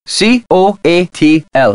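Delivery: letter by letter, slow and separate; spelling it out. Acted. Teaching someone the Nahuatl word for snake, spelling it out. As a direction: spell it out with each letter on its own.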